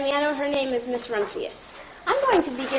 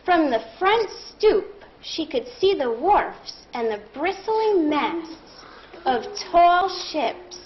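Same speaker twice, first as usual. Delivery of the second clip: slow reread; slower than usual. Shown as a demonstration. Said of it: "I'm going to reread that".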